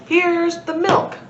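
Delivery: slow, drawn-out triumphant "milk"; triumphant reveal. Acted. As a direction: savour "here's the milk" like a winning card.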